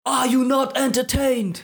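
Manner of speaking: slow, high, grand arena cry; Gladiator quote. Retold or imitated. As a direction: roar it at the crowd like Maximus. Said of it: "ist das nicht aus Gladiator?".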